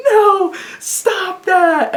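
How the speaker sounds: very high, very slow drawn-out plea; hammed-up stretched-out protest; panicked knight. Knight rushing in to stop Asgore, high and slow. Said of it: "is gonna rush and be like".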